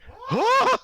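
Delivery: very high, full-volume yelling; piercing startled shouts. Acted. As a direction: yell like a deaf old halfling startled by sound. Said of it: "why am I yelling".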